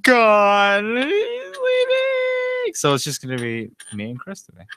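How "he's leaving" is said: very high, stretched-out falsetto; sing-song mock farewell wail.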